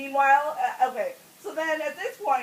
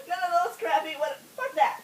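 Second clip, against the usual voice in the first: ordinary delivery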